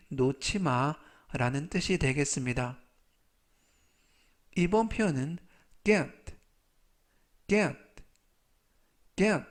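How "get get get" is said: "get" said short and clear; pronunciation model. Shown as a demonstration. Teacher models "get" for learners, short and clear. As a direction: model "get" cleanly, three times. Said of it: "라고 발음하시면 되겠고요".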